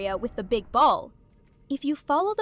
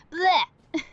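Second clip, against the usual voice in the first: loud, high gagging noise; retching blech; 5 semitones higher, 4 dB louder than usual. Acted. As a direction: fake a disgusted retch. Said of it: "puking and being disgusted".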